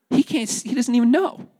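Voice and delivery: high-pitched mock indignation; mock rage. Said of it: "It's like rage".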